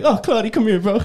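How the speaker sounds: high-pitched pleading call; mock plea. Host retells calling Claudia over, mock pleading.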